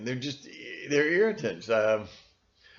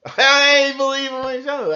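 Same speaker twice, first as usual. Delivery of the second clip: laughing, higher and louder; 8 dB louder than usual. Real, not acted. Speaker mocking his own defiance, laughing higher. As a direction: laugh as you say it again.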